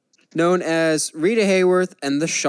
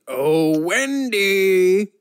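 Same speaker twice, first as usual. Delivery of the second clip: drawn-out, rising mock wrestler call; Samoa Joe; 4 semitones higher, 3 dB louder, much slower than usual. Podcaster riffing on a Wendy character, hammed-up wrestler impression. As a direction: call the name out long and loud, hammed up. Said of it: "In your best Samoa Joe voice".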